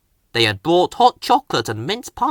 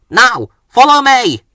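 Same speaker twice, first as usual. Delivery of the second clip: higher louder call; Thomas leading; 9 semitones higher, 9 dB louder than usual. A narrator's reading. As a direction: lead the way firmly. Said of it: "puffed Thomas".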